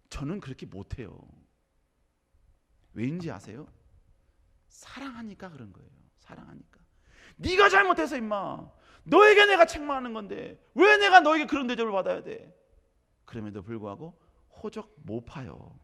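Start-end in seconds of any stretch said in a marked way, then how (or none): 4.7-6.8 much softer, fading to a whisper; tender hush. Acted. 7.4-12.5 higher, louder scolding father; angry father. Retold or imitated. Father's imagined angry retort.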